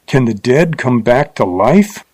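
ordinary delivery

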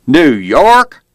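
loud, rising challenge from a cop; slurred, belligerent lawman. A narrator's reading. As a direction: throw it out as a belligerent, high question. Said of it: "his belligerent tone was clear".

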